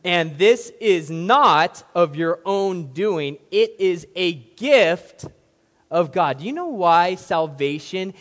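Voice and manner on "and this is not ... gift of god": slow and loud, every word weighted; louder, slow preacher's emphasis on not; emphatic reading. Shown as a demonstration. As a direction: hammer it home for emphasis. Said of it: "One more time for emphasis".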